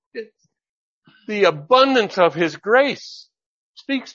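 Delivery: high, driving preacher voice, fast at times; fervent preaching. Acted. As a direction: preach it out, urging the congregation. Preaching with rising fervour.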